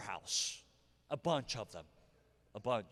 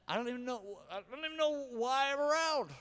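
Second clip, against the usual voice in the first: self-pitying whine; 6 semitones higher than usual. Retold or imitated. Self-pitying inner narrative.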